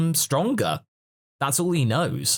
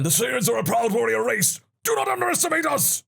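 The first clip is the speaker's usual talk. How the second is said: high shouted battle cry; hammed-up shout, climbing much higher; Vegeta; 10 semitones higher than usual. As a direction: imitate a proud warrior's game voice line. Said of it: "old game quotes ... Vegeta going".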